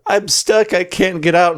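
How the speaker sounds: lightly voiced damsel; mock stuck victim. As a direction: play the fake-stuck actress. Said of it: "we're just like".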